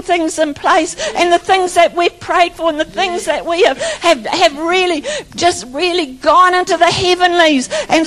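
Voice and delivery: rising sermon fervour; fervent preaching. Acted. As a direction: preach it out over the congregation, building. Preaching at full force.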